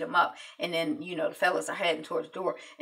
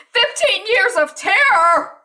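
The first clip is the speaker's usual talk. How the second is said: much higher and louder, slow furious yell; 13 semitones higher, 12 dB louder, slower than usual. Retold or imitated. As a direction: hurl it at the cop. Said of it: "And Eva's yelling".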